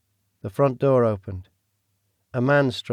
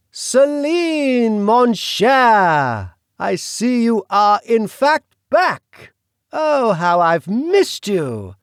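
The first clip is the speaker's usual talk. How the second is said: put-on much higher, slow, theatrical British accent; 11 semitones higher, 6 dB louder, much slower than usual. The Duke greeting Celine in a mocking British accent. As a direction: savour each word in a grand British accent. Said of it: "His British accent".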